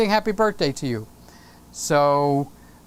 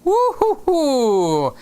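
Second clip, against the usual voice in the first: gleeful woo; 6 semitones higher, much slower than usual. Real, not acted. Cheering for chicken wings.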